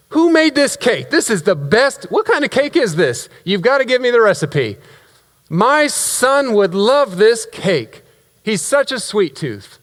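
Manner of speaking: high, excited gushing over cake. Acted. Father gushing over the cake, excited. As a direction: rave with delight, pitched well up.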